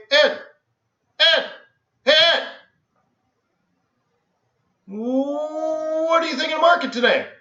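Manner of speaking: calling to Ed across the trading floor. Retold or imitated. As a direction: hail a trader over the pit. Calling across the quiet pit.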